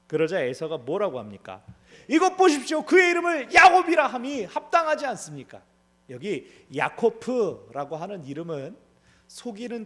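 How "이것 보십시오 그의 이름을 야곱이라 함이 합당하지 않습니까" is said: high, loud indignant protest; indignant Esau. Acted. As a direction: throw the accusation at him.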